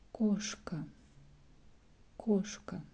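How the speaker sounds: кошка with a full round stressed O; whispered кошка with the O stressed. Shown as a demonstration. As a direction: say кошка with a strong clear O. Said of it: "more strong way".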